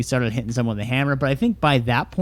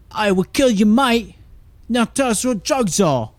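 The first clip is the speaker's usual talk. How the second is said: hammed-up Australian accent, higher and louder; 8 semitones higher, 3 dB louder than usual. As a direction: threaten like an Aussie thug in a put-on Australian accent. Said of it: "I'll try and do my best Australian accent".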